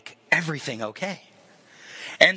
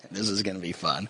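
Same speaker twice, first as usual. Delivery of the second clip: quiet amusement; 9 semitones lower, 8 dB quieter than usual. Retold or imitated. Jesus amused before miracle.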